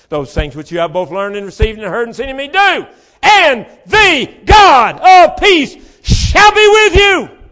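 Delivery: building to a loud, high, drawn-out preacher's shout; full-force scripture reading. Real, not acted. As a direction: thunder the verse out, stretching the last words. Rereads verse at full force.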